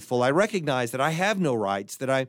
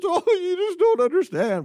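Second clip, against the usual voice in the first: a much higher, whiny crying voice; weeping man; 3 dB louder than usual. Acted. Joke's crying man wails to his friend about his uncle, high and whiny. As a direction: whimper it out like a grown man bawling. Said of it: "crying man in joke".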